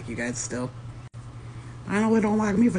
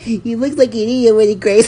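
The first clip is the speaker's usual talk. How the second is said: laughing; 6 semitones higher, 9 dB louder than usual. Real, not acted. Laughing hard at friend.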